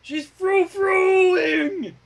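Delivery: high, slow shout; mock alarm. Player joking at the table about frou-frou: high slow shout. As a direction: drag it out in a mock panicked shout.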